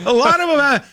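high, laughing voice. Real, not acted. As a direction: laugh through the words. Laughing at fans staying.

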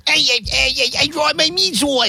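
imitating Danielle. [name]'s take on Danielle spilling sauce, high and slow. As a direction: stretch it out high like a caricature. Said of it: "[name] said".

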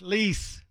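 "Lease" for the last syllable of Angeles; British ending. Shown as a demonstration. Host isolating the British ending, long-EE "Lease". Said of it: "It's lease at the end".

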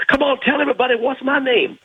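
high, boastful Ali impression; Muhammad Ali. Storyteller voicing Ali boasting to an old man, high and proud.

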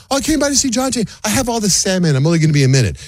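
high, sweet mock wife voice; lightly mocking impression of his wife; imitating wife. Wife voiced in retold story.

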